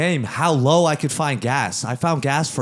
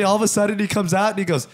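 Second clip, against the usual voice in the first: normal talking voice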